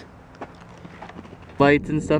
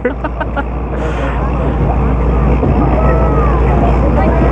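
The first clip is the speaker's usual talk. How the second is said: loud laugh; 13 semitones higher, 6 dB louder than usual. Real, not acted. Big laugh at missed shot.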